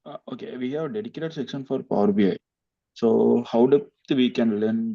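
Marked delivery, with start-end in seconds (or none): 0.1-0.6 barely audible. Real, not acted. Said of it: "It is still low".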